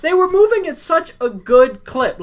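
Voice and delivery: high, loud, laughing complaint; laughing while talking. Real, not acted. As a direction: laugh through the words.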